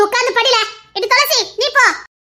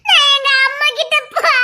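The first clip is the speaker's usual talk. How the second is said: put-on tearful child's wail; acted sobbing, running off to mother; crying; 3 semitones higher than usual. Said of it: "அழுதுகிட்டே போதே".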